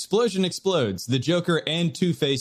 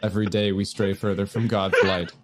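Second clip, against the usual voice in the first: mock solemn; 9 semitones lower than usual. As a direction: intone it like a sad prophet.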